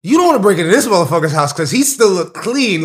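excited, loud fan praise. Real, not acted. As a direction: sell it like a fan.

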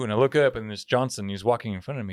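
ordinary delivery

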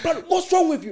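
put-on high, small boyish plea; little boy voice. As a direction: sound like a scared little boy. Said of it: "like, little boy in him".

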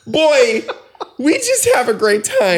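high, loud laughing delivery. Real, not acted. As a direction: laugh through the words. Laughing through gushing line.

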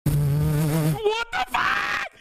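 very high, slow stretched yell; outraged shout. Real, not acted. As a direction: scream it in outrage. Outraged at clip's claim.

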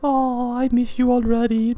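ordinary delivery

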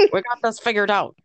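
easygoing cartoon character voice; Soos. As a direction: put on a goofy, confident dude voice. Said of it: "That was my Seuss impression".

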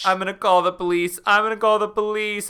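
fast, loud and high; Tamara threatening the old woman; panicked threat. Acted. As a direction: blurt it out in a fright.

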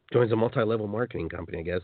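usual speaking voice

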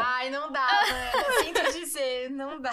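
high, loud laughing reply; giggling mock sympathy. Real, not acted. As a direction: laugh through the teasing answer. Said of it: "laughing mock".

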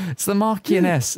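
put-on "Marchioness" said as /mɑɹkiənɛs/, slipping out of the accent. GM as Gavin naming the Marchioness, accent slipping. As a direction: stumble on "Marchioness" in the Kiwi voice. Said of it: "saying Marchioness in".

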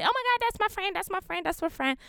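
high gushing mock squeal, fading off; imitating a clingy show-off friend. Mocking people who claim everyone as a best friend, high gushing. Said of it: "I'm not saying be besties with everybody".

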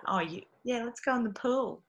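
put-on casual invitation in a Brisbane accent. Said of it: "In Brisbane, they're called togs".

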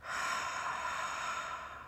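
long soft breathy sigh; audible exhale. Shown as a demonstration. Guide showing listeners the exhale, long soft sigh. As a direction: let the breath out slowly and audibly. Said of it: "and exhale".

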